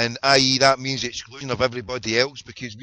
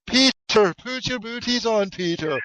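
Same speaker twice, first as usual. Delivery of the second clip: imitated wee lassie; 9 semitones higher, 3 dB louder than usual. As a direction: mimic a little girl calling to Peter. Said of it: "the wee lassie was like".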